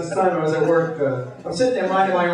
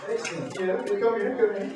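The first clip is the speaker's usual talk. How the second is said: usual speaking voice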